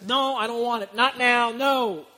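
loud, dragged-out protest of a resisting listener; acted-out obstinate brush-off; stubborn refusal. Acts out listener refusing the warning.

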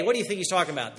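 ordinary talk

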